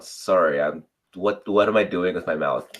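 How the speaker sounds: normal talking voice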